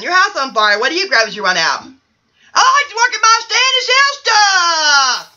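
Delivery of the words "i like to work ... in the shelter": high-pitched put-on voice, held nearly level; odd bachelor character. Bachelor one answering the host's question, high put-on voice. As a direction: chant it high, stretching the final word.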